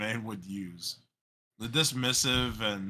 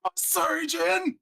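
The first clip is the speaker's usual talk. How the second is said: high, drawn-out meme screech; hammed-up high yell; mock screaming; 5 dB louder, slower than usual. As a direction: chime in with a slow, loud screech.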